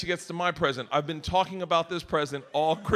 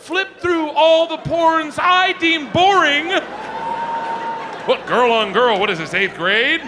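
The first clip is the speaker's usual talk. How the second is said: loud rant; 7 semitones higher, 7 dB louder than usual. Real, not acted. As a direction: bellow it to the crowd. Ranting about porn choices.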